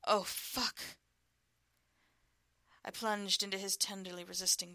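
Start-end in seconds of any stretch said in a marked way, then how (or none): 0.0-0.8 loud, higher gasped oh fuck; loud gasping curse of pleasure. Acted. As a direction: let it break out in pleasure. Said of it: "he gasped".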